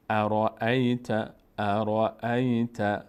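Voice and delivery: ارائیت read very slowly, syllable by syllable, ending drawn out; teacher sounding out ارائیت slowly; slow Quranic reading. Shown as a demonstration. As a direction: stretch out ارائیت slowly as in recitation. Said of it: "اینجا فتحهٔ همزه هست، ای میچسبه به یا".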